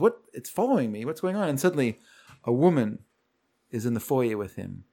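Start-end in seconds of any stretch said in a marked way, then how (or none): 0.0-1.5 mildly bewildered retold questions; confused. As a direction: imitate a confused man, voice lifting. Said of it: "he's confused".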